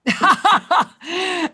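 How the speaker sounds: host laughing with the caller; bright laugh. Real, not acted. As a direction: burst out laughing.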